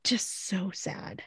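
slow, very soft near-whisper; saddened. Real, not acted. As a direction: let it sink to a hushed, sorrowful breath. Moved by the sad scene.

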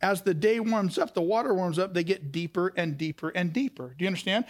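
ordinary talk, nothing marked

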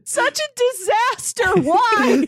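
high-pitched, laughing, slowed-down amazement; laughing disbelief. Real, not acted. Fan laughing to friends about sleeping-bag teacher, high amused disbelief. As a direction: squeak out how absurd he is.

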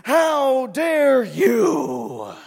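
slow, drawn-out loud angry shout; furious scolding. Retold or imitated. Mother rounds on her son after the scare, slow furious shout. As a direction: hammer each word like a furious mother. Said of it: "it went from little Zachary".

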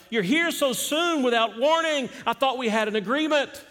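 high, loud startled outcry; startled cry. Acted. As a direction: cry out in alarm. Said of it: "he cried out".

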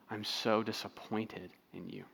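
a quiet, lightly tearful father's voice; soft and low, hinting at tears; tearful dad. Retold or imitated. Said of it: "as he begins to cry, he looks at her and says".

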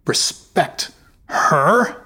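"Respect her?" drawn out slowly with a high incredulous rise; scornful echo. Acted. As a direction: Jarl mocks his wife's word, slow and scornful. Jarl scoffs at wife.

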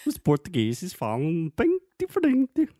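high, loud mock Portuguese accent from Portugal; European Portuguese accent. Husband teasing wife in a hammed-up Portuguese accent. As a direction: ham up a Lisbon accent, way up high. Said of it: "imitate Portuguese people".

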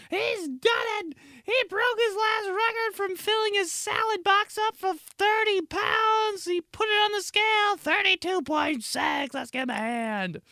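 very high shouted announcer hype; wrestling commentator. Pretend. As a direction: hype the crowd like a ringside announcer. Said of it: "There's an M C there".

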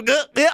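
high, silly "Gah, gah" grunts; random villain noises. Retold or imitated. Host mocks Two-Face's random noises with high silly grunts. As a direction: bark out nonsense "Gah, gah" sounds. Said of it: "Half the movie just go".

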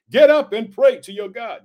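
loud, insistent command from the pulpit; narrated shout. A narrator's reading. Captain ordering Jonah to pray, narrated shout. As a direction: read the order out loud and firm. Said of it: "he shouted".

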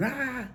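a sudden high "Ah" to startle; mock scare yelp. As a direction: jump out with a playful high yelp. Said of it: "they'll leap out at me going".

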